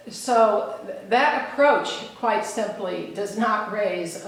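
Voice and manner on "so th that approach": usual speaking voice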